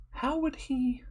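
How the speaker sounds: high, soft, hushed disbelief; baffled inner voice. Acted. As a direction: think it aloud in a high, small voice.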